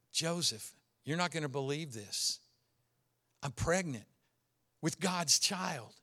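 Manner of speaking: tender hushed confession. Acted. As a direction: break the news gently.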